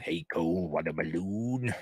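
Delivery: a hint of a creepy clown, soft and slow; lightly voiced sinister clown luring a kid; mild creepy clown coaxing; Pennywise voice.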